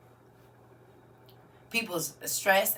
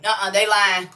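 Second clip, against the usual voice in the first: loud mock warning; 10 dB louder than usual. Real, not acted. Host mock warning viewers.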